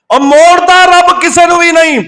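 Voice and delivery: high, sustained proclamation; impassioned preaching cry. Real, not acted. Preacher assuring the congregation nobody is turned away, impassioned cry. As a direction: cry it out high, stretching the words. Preacher repeats line with force.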